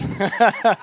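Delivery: short embarrassed burst of laughter; sheepish chuckle; nervous laugh. Real, not acted. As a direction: laugh at your own nerve. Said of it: "I am cooked".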